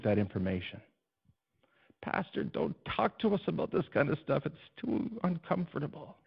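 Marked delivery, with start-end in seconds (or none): 2.0-6.2 higher, softer whiny voice of a reluctant churchgoer; mock protest, high and quiet; mock complaining churchgoer. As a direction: imitate a squirming listener, pitched up and hushed. Mock objection from the congregation.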